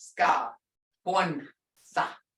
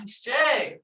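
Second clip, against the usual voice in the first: "Jay" returned in a lifted voice; responding with feeling; 4 semitones higher than usual. Shown as a demonstration. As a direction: answer the greeting with feeling, pitched higher. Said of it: "they'll respond back to you".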